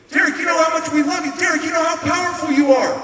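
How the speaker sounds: high, loud, insistent plea; urgent shouted praise. Retold or imitated. Woman praising raging Derek, high urgent shout. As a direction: shout encouragement over someone's rage.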